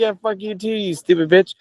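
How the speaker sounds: high, sing-song sweet insult; mock sweet insult. Answering a hater with a high sing-song insult. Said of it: "And I'm like".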